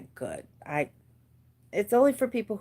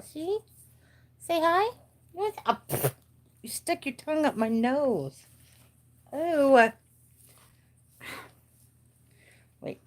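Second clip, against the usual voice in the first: pet talk; 8 semitones higher than usual. Real, not acted. Playing with her excited pet.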